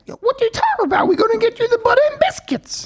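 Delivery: high, fast Eric impression; imitating Eric. Teller voices pushy friend Eric insisting on Timbs, high and fast.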